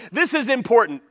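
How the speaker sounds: normal talking voice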